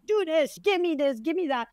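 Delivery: high, insistent imitation of bossy orders; mock mean demands. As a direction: rattle off demands as if meanly meant. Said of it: "You're hearing it mean".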